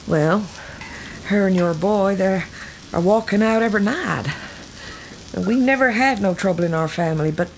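usual speaking voice

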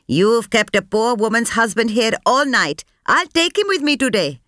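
high, firm scolding of the officer; lecturing. A narrator's reading. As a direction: scold him from above, high. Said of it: "Sampath lectured the officer".

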